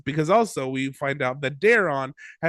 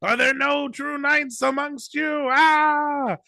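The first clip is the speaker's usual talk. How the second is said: desperate outburst; 9 semitones higher, slower than usual. Retold or imitated. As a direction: lose it in front of the crowd. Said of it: "Dunk loses it".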